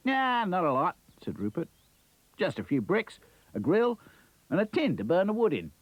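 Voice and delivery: high, casual Aussie voice; easygoing kangaroo with Australian accent. Acted. Kangaroo character voice.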